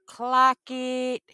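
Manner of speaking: high, hyped "Clock it" at the screen; excited cheer. Retold or imitated. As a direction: cheer it like a fan on the couch. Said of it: "I was like".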